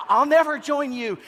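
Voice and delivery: high, loud defiant cry. Retold or imitated. Luke defying Darth Vader, high loud cry. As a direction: shout it as a teen hero. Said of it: "he always had that really high".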